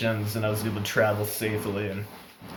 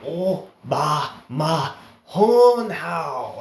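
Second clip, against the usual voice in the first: slow, high mock Chinese accent; mimicked Chinese accent; 6 semitones higher, 5 dB louder, much slower than usual. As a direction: repeat it insistently, stretched out in imitated Chinese accent. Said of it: "he just kept saying it".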